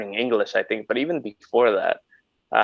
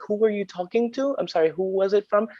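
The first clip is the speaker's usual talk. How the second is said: high-pitched mock-innocent query; imitated support agent; 10 semitones higher than usual. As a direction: feign not knowing, voice pitched up. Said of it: "I would always be like".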